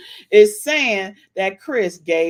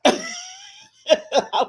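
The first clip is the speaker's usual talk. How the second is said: laughing. Real, not acted. High laughing.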